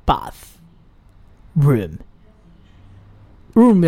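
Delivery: Bath with tongue out, room short and firm, very slow; bitten th, short room. Shown as a demonstration. Teacher shows each part of the word to the class. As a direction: bite the th, then clip room short. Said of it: "要短促有力".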